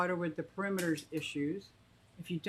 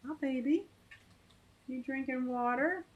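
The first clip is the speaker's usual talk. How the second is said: pet talk; 6 semitones higher than usual. Real, not acted. Greeting the pet bird.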